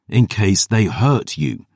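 slow, pointed, each word bitten off; snapped. Acted. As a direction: lean hard on it, angry and slow. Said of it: "I snapped".

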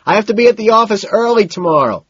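high, loud, sustained yell; hollered to be heard; shouted explanation. A narrator's reading. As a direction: shout the excuse over the din. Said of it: "I shout".

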